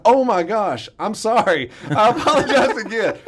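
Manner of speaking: high, laughing sorry; laughing apology. Retold or imitated. Boy apologizing to the coach, laughing and high.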